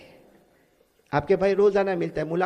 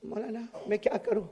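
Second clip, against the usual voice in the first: tearful plea; 11 dB quieter than usual. Retold or imitated. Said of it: "तो रोते रोते".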